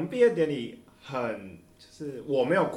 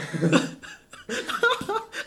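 high laugh. Real, not acted. Laughing at reunion joke.

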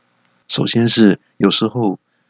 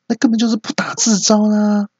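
high, shrill cartoonish exasperation; exasperated shrill voice; 13 semitones higher than usual. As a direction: squeal in disbelief at the blunder. Exasperated outburst. (尖声抓狂的吐槽)

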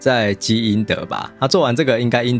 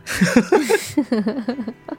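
high laugh; 13 semitones higher than usual. Real, not acted. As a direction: burst of high-pitched laughter. Laughing at funny quote.

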